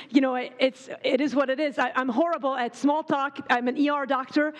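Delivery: ordinary talk, nothing marked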